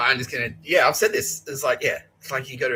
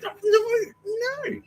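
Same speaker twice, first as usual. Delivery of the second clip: mock dismay; much slower than usual. Turnbull reading my work.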